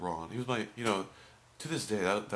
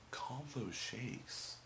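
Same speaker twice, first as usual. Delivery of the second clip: higher, softer, very slow questioning echo; confused clerk sounding out a strange order; puzzled; 5 semitones higher, 5 dB quieter, much slower than usual. Retold or imitated. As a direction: repeat it slowly, as if baffled. Puzzled clerk repeats the odd order.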